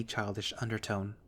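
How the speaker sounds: usual speaking voice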